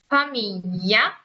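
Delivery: familia at half speed with a long f; slow marked f. Shown as a demonstration. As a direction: lean on the f in familia slowly. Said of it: "estoy marcando bastante".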